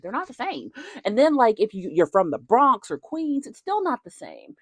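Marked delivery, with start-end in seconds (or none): none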